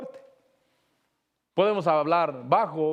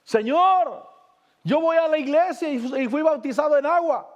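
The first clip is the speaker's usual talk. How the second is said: high, raised mock cry to the Lord; retold shout; 7 semitones higher than usual. Preacher acts out a dying man crying to the Lord, retold high shout. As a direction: imitate a man calling out, pitched well up. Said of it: "Y gritaba".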